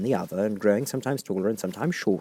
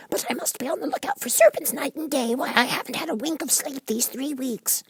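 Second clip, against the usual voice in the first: very high bird voice, complaining; a fussy bird listing its troubles; high fretful pigeon voice. A narrator's reading.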